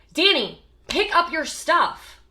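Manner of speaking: loud, high barked order; angry parent yelling at teenager Danny; aggressive parent. Acted. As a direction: snap the order at the teen, slow and hard. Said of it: "That's our aggressive parent".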